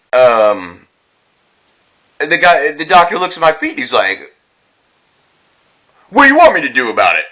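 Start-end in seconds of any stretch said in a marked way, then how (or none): 6.1-7.2 smug. Retold or imitated. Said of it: "real freaking talking down to me, smug".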